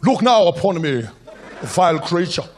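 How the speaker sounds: put-on slow, grand stage delivery in a South African accent; grand theatrical South African accent.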